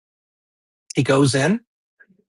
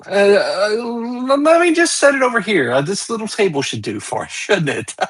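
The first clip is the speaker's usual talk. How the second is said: higher, fussy host with forced little laughs; a lightly voiced flustered rabbi covering unease; nervous rabbi; 7 semitones higher than usual.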